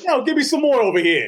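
high, brash New Jersey tough guy; Jersey accent. Retold or imitated. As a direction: swagger it like Jersey. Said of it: "in a Jersey accent".

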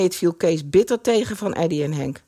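ordinary delivery

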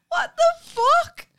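high, loud, slow, drawn-out mock shock; shocked outburst. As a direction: stretch it out in disbelief. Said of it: "I was like".

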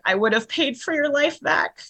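high-pitched, retold plea to the lost; grieving plea. Mother addressing her dead son in retelling, grieving plea. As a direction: plead with someone gone, voice lifted high.

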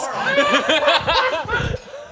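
high helpless laugh; burst of laughter. Real, not acted. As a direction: crack up completely. Cracks up mid ad read.

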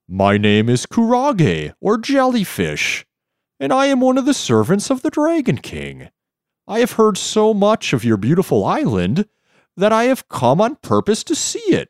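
high, wavering jellyfish voice; wobbly floating jellyfish. Acted. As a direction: float the words in a high warble.

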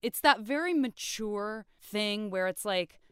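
ordinary delivery

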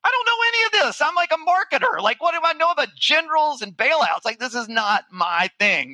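high-pitched mock panic; retold freaking out. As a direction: act out an inner freak-out, pitch way up then easing down. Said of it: "I'm freaking out".

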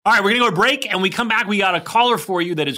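upbeat announcer tease; radio host. Acted. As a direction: pitch the break like a DJ. Host teasing the break.

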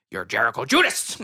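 lightly mimicked wrestler character; Danhausen. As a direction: drop low into the odd persona. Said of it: "that's how he talks the whole time".

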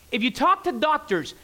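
normal talking voice

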